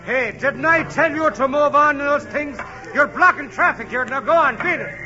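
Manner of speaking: gruff cop. Acted. Cop shouting at stilt walker to move along. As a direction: order him off the street. Policeman again.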